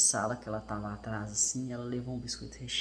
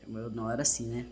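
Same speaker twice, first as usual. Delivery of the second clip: usual speaking voice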